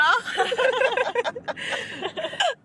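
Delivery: long high giggle; giggling fit; high laugh. Real, not acted. Laughing at catheter slip.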